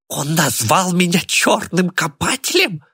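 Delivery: very high, drawn-out squealed question; outraged squeal. A narrator's reading. Old man reacts to the insult, squealing. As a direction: squeal it in high-pitched disbelief. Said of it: "взвизгнул Тимур Кайратов".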